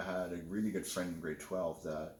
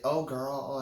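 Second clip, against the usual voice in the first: put-on louder, higher sassy "girl"; super sassy; 6 semitones higher, 5 dB louder than usual. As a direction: toss it off with full sass. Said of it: "it's super sassy".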